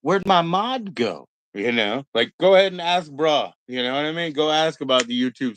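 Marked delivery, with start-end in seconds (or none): 0.0-1.3 slow, high, whiny and put-upon; mock whiny question. Mocking a returning user who lost his mod status, slow and whiny. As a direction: drag out a sulky complaint. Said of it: "they're like".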